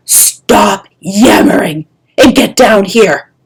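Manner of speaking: loud, slow roar. Acted. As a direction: order him down at once. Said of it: "Kestrel roared".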